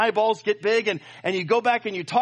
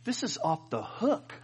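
soft, slow, laughing through it; laughing low delight; mock cool praise; 3 semitones lower, 7 dB quieter, slower than usual. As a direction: say it with a laugh, half embarrassed. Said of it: "I said something amazing like".

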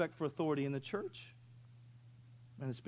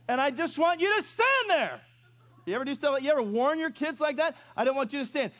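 high, loud strict warning; stern parent warning; 11 semitones higher, 8 dB louder than usual. Retold or imitated. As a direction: lay down the law to the kids.

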